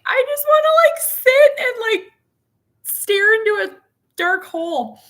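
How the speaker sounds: high, loud, exasperated wish to escape; fed-up inner voice. Retold or imitated. Mom imagining escape, blurted high and loud. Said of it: "there's times where I'm like".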